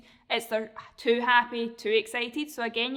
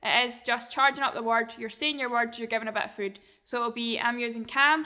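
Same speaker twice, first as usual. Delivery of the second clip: normal talking voice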